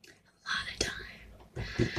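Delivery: slow breathy whisper, barely there; whispered echo. Shown as a demonstration. Echoing her regret to viewers in a slow whisper. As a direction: drop to a slow whisper on "A lot of time".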